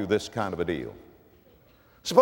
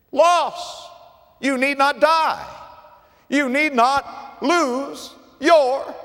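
preaching at full force; 11 semitones higher, slower than usual. Acted. Preacher begging the unsaved not to lose their souls, slow and raised. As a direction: plead with them, stretching the words. Final appeal to the congregation.

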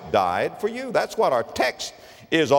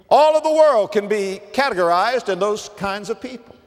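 preaching at full force; 6 semitones higher, 3 dB louder than usual. Acted. Preacher contrasting Satan and Jesus, raised full-force preaching. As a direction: ring it out from the pulpit.

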